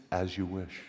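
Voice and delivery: hushed lovesick Wesley line; soft devoted reply. Retold or imitated. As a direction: answer her gently, lovingly. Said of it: "all throughout the movie".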